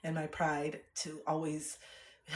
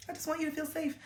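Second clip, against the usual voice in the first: sweet placating; 10 semitones higher, 4 dB quieter than usual. Retold or imitated. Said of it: "I was just kinda like".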